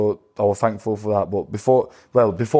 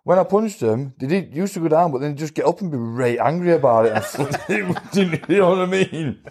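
high, laughing through the boast; 7 semitones higher than usual. Real, not acted. Boxer laughing to the podcast host about his punch. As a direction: chuckle your way through it. Laughing while telling the fight story.

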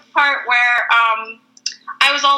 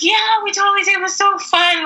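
high bubbly yes; excited; 9 semitones higher than usual. Retold or imitated. Telling the Atlanta friend, high and bubbly. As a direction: gush about the fun.

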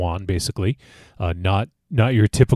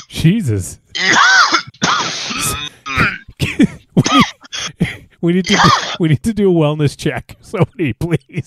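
laughing; 12 semitones higher, faster than usual. Real, not acted. Giggling after huge bong hit.

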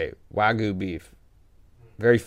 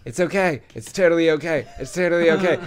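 higher-pitched mock reassurance; imitated placating friend; 5 semitones higher than usual. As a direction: imitate a friend timidly smoothing things over. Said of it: "Miz was in the back like".

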